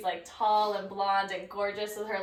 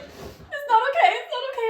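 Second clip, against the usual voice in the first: high laughing panic; 7 dB louder than usual. Retold or imitated. Friend retelling the stylist's panic at the mirror, squealed high through laughter.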